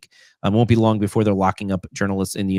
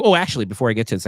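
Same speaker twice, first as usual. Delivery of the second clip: ordinary talk, nothing marked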